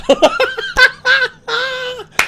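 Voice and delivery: big high burst of laughing; real belly laugh; loud laughter. Real, not acted. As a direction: crack up loudly. Laughing at the prank.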